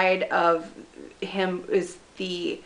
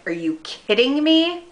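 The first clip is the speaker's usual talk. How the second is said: retold disbelief, pitched up; incredulous retort; 7 semitones higher, 4 dB louder than usual. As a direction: raise the voice in mock disbelief. Retold shocked reply to friend.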